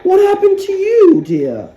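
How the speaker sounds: high and loud, a startled cry of concern; surprised cry. Acted. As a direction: gasp it out in shocked dismay. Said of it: "she cried".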